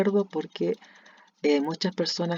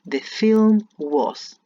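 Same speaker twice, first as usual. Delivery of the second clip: very slow, louder, words stretched; slow emphasis; 5 semitones higher, 7 dB louder, much slower than usual. Shown as a demonstration. Teacher stretching the word order for the class. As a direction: lean on each word of "The film was". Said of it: "el verbo to be va al final".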